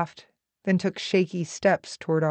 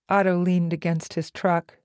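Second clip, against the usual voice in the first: ordinary delivery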